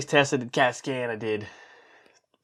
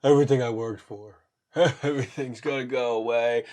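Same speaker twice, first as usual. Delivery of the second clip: mock despair; 2 semitones higher, 4 dB louder than usual. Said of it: "I'm just thinking of".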